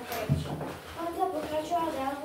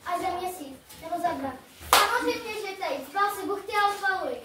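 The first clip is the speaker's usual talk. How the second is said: sarcastic father; 5 semitones higher, 8 dB louder than usual. Acted. Sarcastic father urging son.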